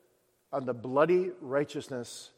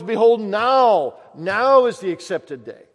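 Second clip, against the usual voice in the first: loud, slow, ringing proclamation; proclaiming; 6 semitones higher, 12 dB louder, slower than usual. Acted. Preacher urging sinners to act now, loud proclamation. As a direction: thunder out the urgency.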